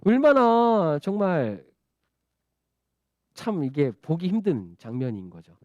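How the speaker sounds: ordinary talk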